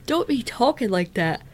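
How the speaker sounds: talking through a yawn; words stretched by a yawn. Real, not acted. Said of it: "you made me yawn".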